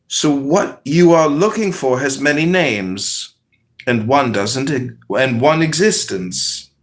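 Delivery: calm, measured parable reading; unhurried storyteller voice; story narration. Acted. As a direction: tell it like a wise fable. Reads a spiritual parable aloud.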